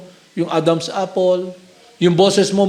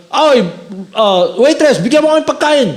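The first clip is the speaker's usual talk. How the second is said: calling the waitress; 6 semitones higher than usual. Retold or imitated. Drinker calling out to the waitress for food. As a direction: hail the waitress over the noise.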